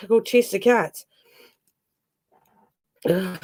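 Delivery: usual speaking voice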